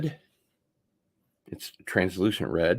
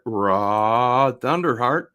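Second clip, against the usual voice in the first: loud, higher, slowly stretched name; drawn-out name call; 4 semitones higher, 6 dB louder, much slower than usual. Pretend. Streamer to viewer, drawing out the name loudly. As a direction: stretch the name out, half speed.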